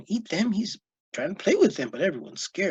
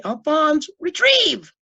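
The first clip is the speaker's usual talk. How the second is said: high, loud call to the pet; commanding the pet; 11 semitones higher, 7 dB louder, slower than usual. A narrator's reading. Boy training his pet, high and loud. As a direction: call the dog-like pet to fetch. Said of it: "Lois would say".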